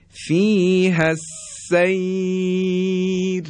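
فیه السیر recited slowly, the ر kept thin; drawn-out melodic Quranic example; thin R recitation. Shown as a demonstration. Teacher demonstrates the thin ر in slow recitation.

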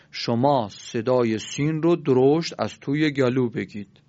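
slow, heavy voice pushed back into the throat; comically throaty, slowed-down demonstration; thick throaty voice. Shown as a demonstration.

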